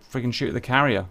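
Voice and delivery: ordinary delivery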